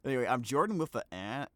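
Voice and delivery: ordinary delivery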